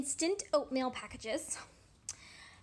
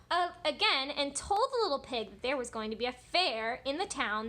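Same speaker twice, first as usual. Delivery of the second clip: lively, louder read-aloud with big swoops; story read to children; 3 semitones higher, 8 dB louder than usual. A narrator's reading. Teacher reading the Three Little Pigs to children, animated storytelling. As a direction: read it animatedly, stretching key words.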